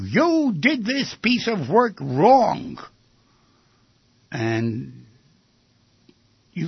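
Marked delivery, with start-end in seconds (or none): 0.0-2.8 high, slow, drawn-out scolding; mock boss yell. Boss berating an employee over work, mock yell. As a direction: act out a boss telling someone off. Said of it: "but he yells at you".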